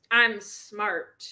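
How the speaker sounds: normal talking voice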